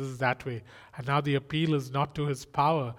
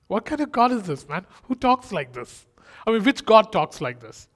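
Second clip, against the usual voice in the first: louder and higher, marveling; amazed preacher's cry; amazed outburst; 6 semitones higher, 8 dB louder than usual. Real, not acted. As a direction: exclaim in wonder at this God. Preacher marvels at God's words.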